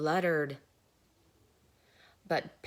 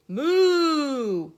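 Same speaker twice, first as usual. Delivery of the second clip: cow moo; 7 semitones higher, 11 dB louder than usual. Acted. Cow plowing into the water.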